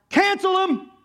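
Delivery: high, emphatic call to cancel; mock rallying cry. Preacher mimics church folk condemning someone, high rallying cry. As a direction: throw it out high like a crowd's demand.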